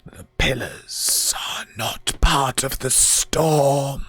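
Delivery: slow, whispered undead voice; rasping whisper. Acted. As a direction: breathe it out like a ghost. Door spirit answers.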